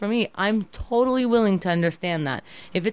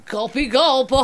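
higher, louder, slow mock boast; mocking drunk boyfriend; 6 semitones higher, 5 dB louder than usual. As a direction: ham up his smug drinking. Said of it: "mocking him".

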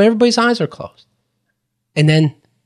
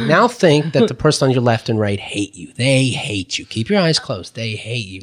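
low guiding voice, sinking softer; seminar leader; 6 semitones lower, 4 dB quieter than usual. Retold or imitated. Leader tells the group to keep eyes closed, low guiding voice. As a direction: plant the idea quietly, voice dropping away.

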